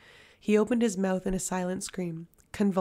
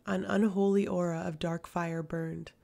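usual speaking voice

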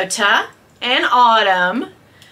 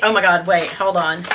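normal talking voice

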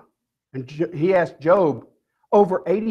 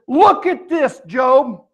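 higher, emphatic command from a whirlwind; God; 5 semitones higher, 4 dB louder, much slower than usual. Retold or imitated. God speaks to Job from a whirlwind, commanding. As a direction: order him to look, pitched up. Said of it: "God talking to him out of a whirlwind".

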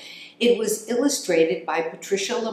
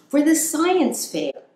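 much higher, louder little-critter voice; high hamster voice; 10 semitones higher, 3 dB louder than usual. Acted. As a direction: announce it like an eager tiny hamster. Hamster Max continues quote.

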